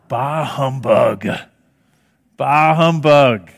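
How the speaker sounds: put-on slow, mean old miser's grumble; Scrooge. Miser's reply to anything Christmas, mean and slow. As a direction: drag it out with miserly contempt. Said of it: "so mean and so miserly".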